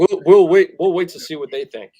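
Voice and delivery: high, loud mock of a timid GM; mocking quote. Host mocking the GM's noncommittal press answer, high and loud. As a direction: sneer out the cautious company line.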